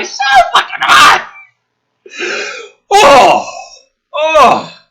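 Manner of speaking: a high, loud yell of disbelief; all-out exasperated yell; furious shout. Real, not acted. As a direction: scream it at the page. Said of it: "yelling and screaming".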